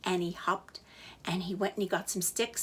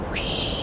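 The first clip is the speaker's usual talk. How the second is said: whoosh; 4 dB louder than usual. A narrator's reading. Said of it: "the wind went".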